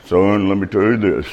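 low, grave mentor voice; lightly hushed, deep imitation of a mentor; imitating his mentor. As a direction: drop down low like a counseling elder. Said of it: "That's how he talks".

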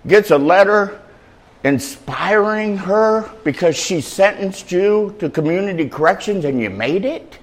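ordinary delivery

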